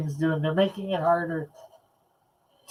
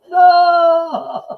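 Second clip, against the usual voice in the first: high, loud shrieked no; squeaky shout; 12 semitones higher, 10 dB louder than usual. Acted. Squeaky voice shouting no at the kitties. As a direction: yelp it out shrill and high. Cat voice yelling no.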